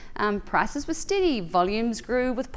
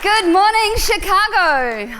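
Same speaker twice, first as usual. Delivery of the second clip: loud, drawn-out hello to the crowd; big stage greeting; 9 semitones higher, 8 dB louder, slower than usual. Acted. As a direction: greet the whole hall like a rock star. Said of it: "I've always wanted to say something like that".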